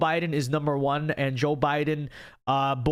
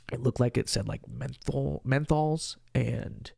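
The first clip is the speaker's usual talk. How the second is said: ordinary delivery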